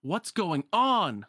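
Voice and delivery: high, drawn-out yell; frustrated yell. Acted. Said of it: "throws it down in frustration and yells".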